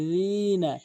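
যিনা with tongue tip at teeth; long-vowelled যিনা with dhal; correct dhal. Shown as a demonstration. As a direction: soften য in যিনা to voiced th. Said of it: "দাঁতের আগা থেকে উচ্চারণ হবে".

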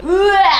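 high, short noise of disgust; high-pitched comic retch; mock disgusted "bleh". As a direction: blurt out an exaggerated yuck sound. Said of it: "Ecco cosa ne penso".

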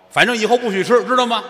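stern teacher. Retold or imitated. Teacher scolding student.

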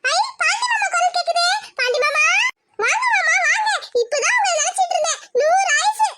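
high, fawning voice with giggles; sugary young woman. Acted. Jothi greeting her cousin Pandi, high and fawning. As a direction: coo at him, high and eager.

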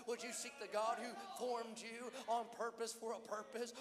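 normal talking voice

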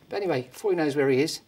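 usual speaking voice

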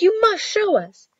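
put-on high, insistent boy; crying out. A narrator's reading. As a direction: plead it with the pitch jumping up. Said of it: "the boy cried".

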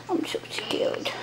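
whispered, slow and frightened; scared whisper. Acted. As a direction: whisper it as if hiding from mom. Hiding under the table, scared.

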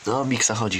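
ordinary delivery

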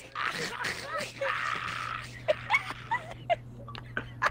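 high breathless wheeze laugh; helpless high-pitched wheezing. Real, not acted. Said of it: "What the fuck is going on?".

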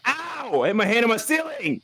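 sharp high yelp of pain. Real, not acted. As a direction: yelp as if you hit your hand. Hit his hand for real.

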